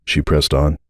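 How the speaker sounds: ordinary delivery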